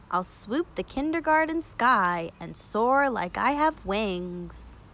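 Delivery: ordinary delivery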